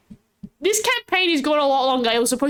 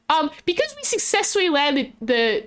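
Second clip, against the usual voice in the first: ordinary talk, nothing marked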